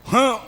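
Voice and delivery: short, high karate "ha"; imitated karate shout. Preacher mimicking a man striking in karate, short high shout. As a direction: let out a quick mock karate cry. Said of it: "karate அடிக்கிறான்".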